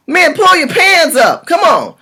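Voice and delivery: ordinary talk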